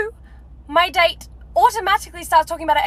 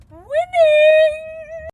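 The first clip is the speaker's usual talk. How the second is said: high gleeful cheer; triumphant; 10 semitones higher than usual. Real, not acted. After date story, high triumphant cheer. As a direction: crow the win.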